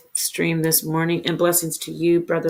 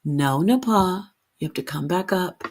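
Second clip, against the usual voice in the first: usual speaking voice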